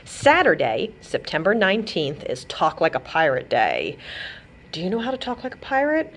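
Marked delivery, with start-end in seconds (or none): none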